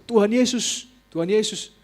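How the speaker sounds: loud, high call to Jesus; calling out prayer. Retold or imitated. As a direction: cry the name out urging them to follow. (seruan doa yang keras)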